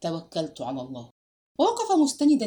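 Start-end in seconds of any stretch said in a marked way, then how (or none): 0.0-1.1 a soft, low mutter under his breath; quiet murmured trust in God; muttered prayer. A narrator's reading. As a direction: murmur it to yourself, softer and lower. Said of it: "غمغم".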